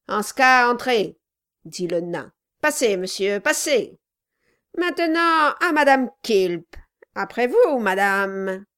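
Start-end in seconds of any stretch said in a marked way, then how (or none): 0.1-1.2 loud, gruff dwarf. A narrator's reading. The dwarf's voiced lines. 2.6-8.6 loud, sneering politeness; mock-polite dwarf. A narrator's reading.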